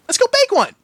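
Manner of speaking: high, loud pony voice; pestering Pinky. A narrator's reading. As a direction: whine for cake.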